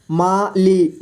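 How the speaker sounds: माली split into मा, ली; syllable by syllable. Shown as a demonstration. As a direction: separate मा and ली in Mali. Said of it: "थोड़ा fast पढ़ेंगे". (माली को तोड़कर: मा, ली)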